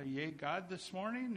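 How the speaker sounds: ordinary talk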